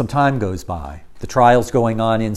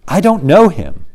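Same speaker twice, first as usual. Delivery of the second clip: sworn denial; 6 semitones higher, 6 dB louder than usual. Acted. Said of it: "and swears".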